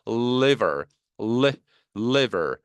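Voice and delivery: "liver" started from a held "으"-like L; L with a run-up. Shown as a demonstration. Teacher showing how to start up the L, drawn-out L in "liver". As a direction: hold the L of "liver" before releasing the vowel. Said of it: "으 하면서 시동 걸어주는 거예요".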